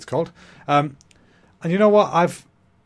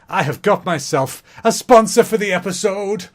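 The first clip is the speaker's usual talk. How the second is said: excited announcement; 7 semitones higher, 5 dB louder, slower than usual. Acted. Announcing a sponsor with fanfare.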